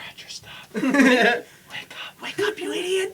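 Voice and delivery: hissing whisper, ending in a sharp high hiss; whispered wake-up. Pretend. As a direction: whisper at the sleeping passenger to wake up. Imagined staff waking the passenger.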